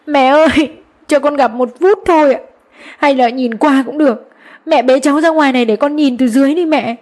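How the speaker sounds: high, tearful wailing plea; crying and wailing. Acted. Mother begging from below the balcony to see her son, crying plea. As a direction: sob it out high and desperate. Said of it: "Tôi vừa khóc vừa gào lên".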